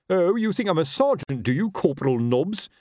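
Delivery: pompous, accusing question; affronted officer. A narrator's reading. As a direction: pounce on the slip, offended. Captain pounces on wrong title.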